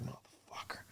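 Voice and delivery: breathy whispered curse under his breath. Retold or imitated. Soldier pulls teeth from medic's mouth, whispered curse. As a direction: whisper it, dismayed.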